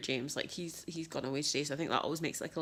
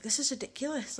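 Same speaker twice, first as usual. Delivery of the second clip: higher, exasperated burst at herself; exasperated outburst; 9 semitones higher, 4 dB louder than usual. Retold or imitated. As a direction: snap at yourself in disbelief.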